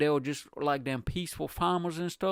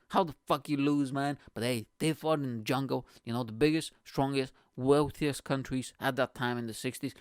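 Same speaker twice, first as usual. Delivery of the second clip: usual speaking voice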